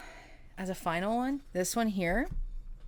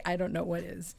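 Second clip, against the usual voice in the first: ordinary talk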